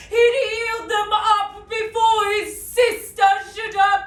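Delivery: building to a high, slow, ringing shout, then easing; impassioned Shakespearean monologue. Acted. Sister speaking of her brother's honour, impassioned classical declamation. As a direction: declaim it with mounting outrage.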